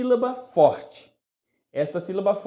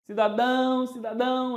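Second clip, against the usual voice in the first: calling the dog; 6 semitones higher than usual. Pretend. Teacher pretends to call the little dog Cidadão, high and stretched. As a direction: hold the last syllable high, summoning a little dog. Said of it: "O nome da minha cadelinha é Cidadão".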